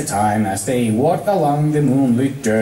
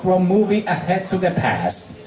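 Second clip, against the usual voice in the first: ordinary delivery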